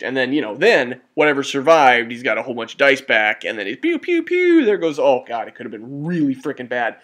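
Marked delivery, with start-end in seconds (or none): 3.8-4.7 pew pew, the last ones much higher; playful zap sounds; laser sound effect. Pretend. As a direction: toy blaster noises from the mouth. Imitating spell blasts.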